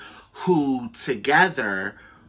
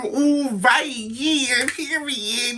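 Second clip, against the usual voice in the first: inner glee; 9 semitones higher, slower than usual. Retold or imitated. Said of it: "in my head I'm thinking".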